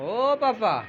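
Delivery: father calling his sulking daughter, sing-song and coaxing. Real, not acted. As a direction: coax her out of the sulk with a drawn-out call. Father pleading with sulking child.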